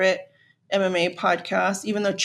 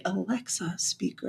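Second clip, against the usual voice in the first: whispering the word speaker; whispered; 2 semitones lower, 9 dB quieter than usual. Real, not acted. Whispering so Alexa won't wake up. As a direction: hush it so the device won't hear. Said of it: "I don't wanna say it too loud 'cause she'll answer".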